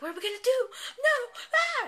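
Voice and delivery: high, loud mock screaming; hammed-up hysterical panic; panicking crowd. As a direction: shriek as if the world is ending.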